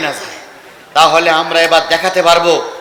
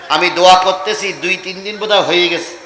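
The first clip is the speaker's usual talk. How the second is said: ordinary talk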